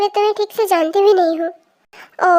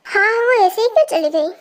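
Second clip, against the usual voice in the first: ordinary talk, nothing marked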